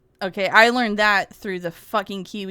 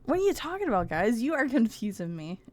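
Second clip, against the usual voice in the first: ordinary delivery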